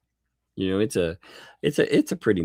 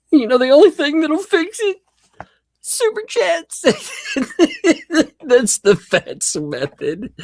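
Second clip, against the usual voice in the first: high, loud, giggling beg; laughing mock plea; 8 dB louder than usual. Joking to chat about super chats, squeaky laughing plea.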